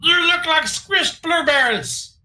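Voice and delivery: whiny kobold begging the king; squeaky kobold voice. Acted. Sir Dim mocks dragon.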